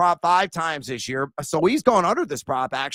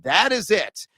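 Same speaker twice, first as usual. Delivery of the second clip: ordinary delivery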